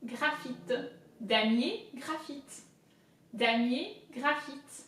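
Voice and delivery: "Damier, graphite" in careful French; "graphite" with throaty R and an "ee" sound; French word drill, each word set apart; French pronunciation. Shown as a demonstration. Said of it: "I in French is I".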